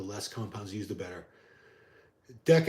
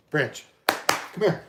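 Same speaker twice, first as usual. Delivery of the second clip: slow, higher sing-song call to the dog; 5 semitones higher, 4 dB louder, much slower than usual. Real, not acted. Owner calling his dog Branch over, slow higher sing-song call. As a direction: stretch it out to coax a dog over.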